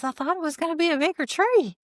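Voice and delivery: high, fast mock whimper; distressed whine. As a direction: squeak it out like she's about to cry. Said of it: "Robin's got this distressed look".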